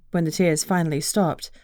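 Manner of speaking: ordinary delivery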